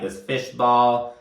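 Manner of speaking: normal talking voice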